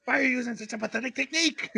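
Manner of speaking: high, fast, over-the-top disappointment; hammed-up emotional mentor; emotional scolding. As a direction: scold like a heartbroken best friend.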